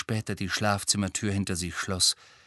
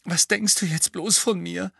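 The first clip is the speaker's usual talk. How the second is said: high, trembling apology; shaky voice; 10 semitones higher than usual. A narrator's reading. Molly apologizing shakily to Harry after crying. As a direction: let the voice tremble as you apologize. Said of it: "sagte sie zittrig".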